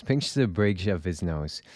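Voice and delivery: ordinary delivery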